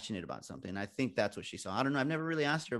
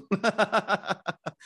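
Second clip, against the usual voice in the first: high, loud burst of laughter; 9 semitones higher, 6 dB louder than usual. Real, not acted. Speaker laughing at their own story, high and hearty.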